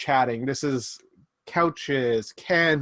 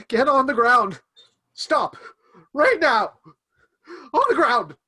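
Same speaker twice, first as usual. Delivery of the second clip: much higher, louder barked orders, nerves showing; frightened guard yelling; 11 semitones higher, 6 dB louder than usual. Acted. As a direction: order them down at gunpoint. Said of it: "He says".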